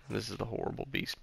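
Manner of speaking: ordinary talk, nothing marked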